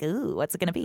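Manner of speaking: a hint of a mischievous troll. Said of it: "That's my troll voice".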